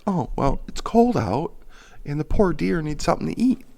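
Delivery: high, put-on pitying voice; sing-song mimicry, pitched well up; mock sympathy. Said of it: "And you're like".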